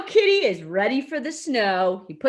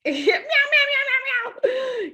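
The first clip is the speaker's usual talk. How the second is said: high, repeated kitten mews; cat meowing; 10 semitones higher, slower than usual. A narrator's reading. Teacher showing children a trapped cat, high frantic meowing. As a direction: mew urgently up high. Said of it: "Does that sound like a cat?".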